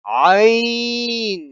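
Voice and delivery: عین drawn slowly from the throat; عین long and throaty; throaty ain. Shown as a demonstration. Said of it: "عین بھی حلق سے نکلے گا".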